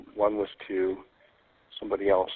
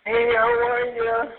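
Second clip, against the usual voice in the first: distant, hollow phone sound; echoing tunnel; 12 semitones higher, slower than usual. Real, not acted. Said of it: "You sound like you're in a tunnel".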